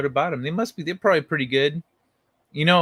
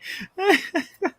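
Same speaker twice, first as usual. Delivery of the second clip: high laugh. Real, not acted. Big laugh.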